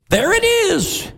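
high, thrilled cry of discovery; excited exclamation. Real, not acted. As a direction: announce it like you just found it.

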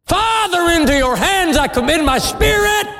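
high cry from the cross; cried out. Acted. Preacher voicing Jesus on the cross, a high cry. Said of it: "he cried with a loud voice".

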